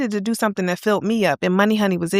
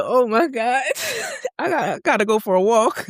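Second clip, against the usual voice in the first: half-laughing panic at the news; stunned and giddy; overwhelmed oh my god; 2 semitones higher than usual. Retold or imitated. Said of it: "I said".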